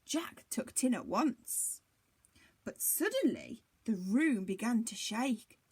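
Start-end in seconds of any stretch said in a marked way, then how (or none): none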